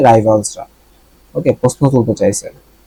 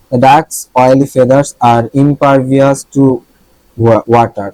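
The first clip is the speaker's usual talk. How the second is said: slow dictation reading; much slower than usual. Shown as a demonstration. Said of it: "reads example sentence slowly".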